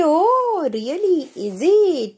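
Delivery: high, loud, drawn-out sarcastic oh really; sarcastic mock surprise. Wife mocking husband who praised his ex, sarcastic. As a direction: stretch it out in scorn.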